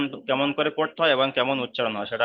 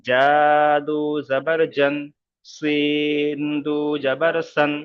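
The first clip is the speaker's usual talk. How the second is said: slow, deliberate tanween recitation; slow recitation drill; much slower than usual. Shown as a demonstration. Teacher slowly models the alphabet with double fatha. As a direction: draw out each syllable for students to copy. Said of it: "প্রথমে দু জাবার লাগিয়ে ... পড়বো".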